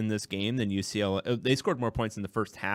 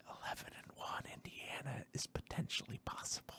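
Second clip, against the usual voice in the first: whisper; 5 semitones higher, 18 dB quieter than usual. Real, not acted. Superstitious hush about winning streak.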